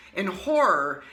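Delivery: usual speaking voice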